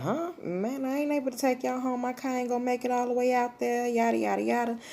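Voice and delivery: high, put-on voice for the cousin; imitating the cousin's lazy excuse in a high voice; groggy cousin. Sleepy cousin refuses the ride.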